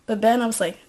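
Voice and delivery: normal talking voice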